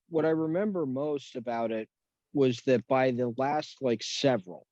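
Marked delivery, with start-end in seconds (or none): none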